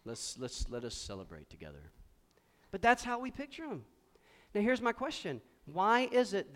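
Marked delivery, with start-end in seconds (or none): 0.0-1.9 low, hushed, lifeless mock Jesus; stoic Jesus. Preacher mocking a joyless Jesus, low and soft monotone. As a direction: drone it out with no joy. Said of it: "I don't see Jesus going".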